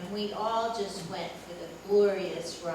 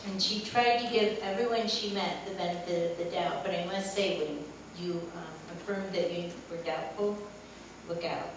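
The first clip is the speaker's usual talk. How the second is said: normal talking voice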